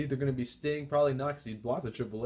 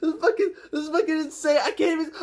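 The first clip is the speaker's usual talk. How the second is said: much higher and louder, barely coherent; overjoyed shouting; 14 semitones higher, 8 dB louder than usual. Real, not acted. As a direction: gush with uncontrollable joy. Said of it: "I'm so happy right now".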